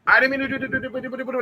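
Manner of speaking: high-pitched rapid confession running into nonsense; imitated fast babbling. People confessing everything as they die, imitated fast babble. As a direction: rattle it off high until the words blur. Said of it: "like, speaking fast shit".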